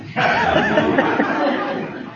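high breathless laugh; airy laugh. Real, not acted. Laughing at the memory of the standoff. Laughing at his own story.

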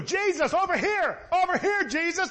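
high-pitched calling for attention; acted yelling. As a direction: call out high as if across a crowd. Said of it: "They could have yelled too".

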